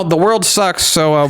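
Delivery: usual speaking voice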